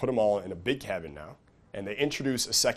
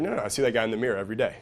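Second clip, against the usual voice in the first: rushed protest; 3 dB louder, much faster than usual. Retold or imitated. You protesting a stranger's doubt.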